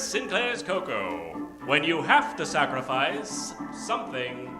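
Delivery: ordinary talk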